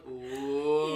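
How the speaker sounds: long rising, swelling "oh"; slow-building crowd roar. Retold or imitated. Storyteller imitating party crowd as fight builds, swelling roar. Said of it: "It's like a slow build".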